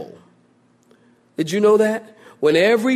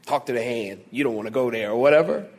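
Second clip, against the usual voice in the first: lower, softer, fast brush-off; dismissive sass; 7 semitones lower, 5 dB quieter, faster than usual. Retold or imitated. Preacher mocks dismissive people, quick sassy brush-off. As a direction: wave it away like a sassy teen. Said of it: "people say".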